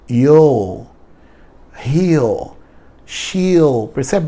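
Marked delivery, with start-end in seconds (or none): none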